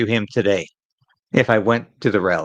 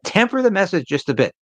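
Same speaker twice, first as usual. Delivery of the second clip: higher, lightly voiced party moderate urging "Temper the message"; mocking the moderates; 7 semitones higher than usual. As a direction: parrot the red Tory advice in a mock voice. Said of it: "by his red Tory faction".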